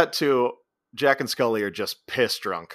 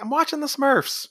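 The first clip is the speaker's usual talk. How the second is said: high-pitched mock indignant protest; whiny protest. Guy in Jack's bed refusing to leave, high mock protest. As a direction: pitch it way up as a petulant objection. Intruder protests to Jack, retold.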